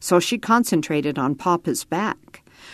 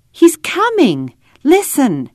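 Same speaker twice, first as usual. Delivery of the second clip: high, louder burst of excitement; excited child; 9 semitones higher, 5 dB louder than usual. A narrator's reading. Ada tells Roy Papa is coming, high excited cry. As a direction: announce good news in a child's high voice. Child hears father answering.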